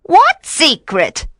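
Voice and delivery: high, drawn-out witch voice; questioning witch. A narrator's reading. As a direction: ask in a high, stretched voice. Trapped witch feigns ignorance.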